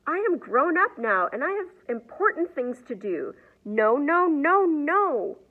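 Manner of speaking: a grown woman throwing a loud tantrum; protesting yell. A narrator's reading. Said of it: "yelled Ashley's mom".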